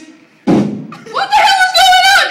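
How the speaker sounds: very high, drawn-out mock yell; loud, shrieked impression of mom; imitating mom bursting in. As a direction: burst in hollering like an outraged mother. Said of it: "then she started doing this".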